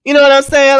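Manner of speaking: loud, high You know what I'm saying; emphatic. Real, not acted. Host to viewers, demanding they agree, loud.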